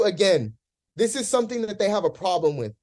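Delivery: ordinary delivery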